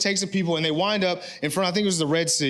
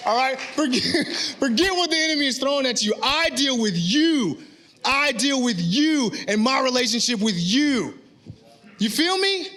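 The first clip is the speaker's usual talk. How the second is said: preaching at full force; 4 semitones higher than usual. Real, not acted. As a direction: hammer the point home to the pews. Keeps preaching amped after laugh.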